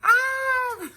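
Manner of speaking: high sudden shriek of fright; retold scream. Child seeing a ghost at her door, shrieking. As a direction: let out a short panicked scream. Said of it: "and I just yelled".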